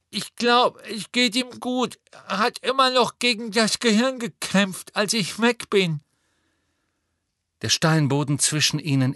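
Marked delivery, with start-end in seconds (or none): 0.1-6.0 lightly voiced anxious boy, high; a hint of a shaken schoolboy; breathless young boy. A narrator's reading. Neville voiced in the story.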